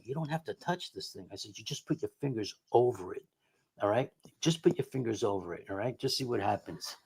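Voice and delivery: soft, gentle persuading; coaxing. Retold or imitated. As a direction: coax her quietly into trying it. Coaxing wife to try board.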